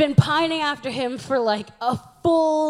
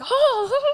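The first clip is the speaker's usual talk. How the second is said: pained laugh; 9 semitones higher than usual. Real, not acted. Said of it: "I was like".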